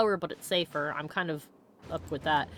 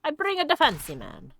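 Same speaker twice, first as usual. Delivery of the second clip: hammed-up Italian accent, pitched higher; fancy Italian accent; 8 semitones higher than usual. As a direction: play a showy Italian gentleman. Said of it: "did that with a fancy Italian accent".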